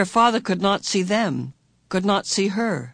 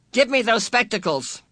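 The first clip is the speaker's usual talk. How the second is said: higher, sharp demand; harsh command; 6 semitones higher than usual. A narrator's reading. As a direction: order her to hand them over. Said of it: "came in a harsh command".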